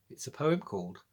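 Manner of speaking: ordinary delivery, nothing marked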